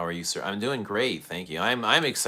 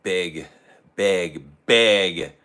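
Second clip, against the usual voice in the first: each big louder, ending in a loud burst; building emphasis; 8 dB louder, much slower than usual. Real, not acted. Perfume host to viewers, rising to a loud big. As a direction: build the word up to a near shout.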